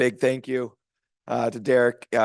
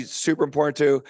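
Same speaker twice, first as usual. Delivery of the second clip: usual speaking voice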